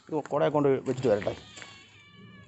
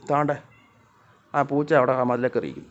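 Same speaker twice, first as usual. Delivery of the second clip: normal talking voice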